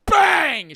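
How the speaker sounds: loud, high mock gunshot; gunshot sound. Retelling the movie, imitating the shot with a loud bang. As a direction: blurt out a sudden bang.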